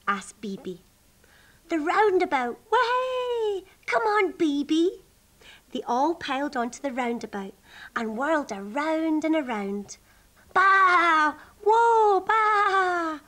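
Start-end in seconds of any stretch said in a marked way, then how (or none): 1.7-5.0 high, whooping sheep; excited calling. Acted. Sheep call the rabbit onto the roundabout, whooping high. 10.5-13.2 long, high, loud baas and whoa; whooping bleats. Acted. Sheep whirling on the roundabout, bleating with high whoops.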